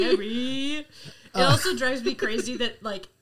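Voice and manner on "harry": imitated call with a drawn-out ending; Neville. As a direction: stretch the final Y, mimicking the narrator. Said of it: "He does that for Neville".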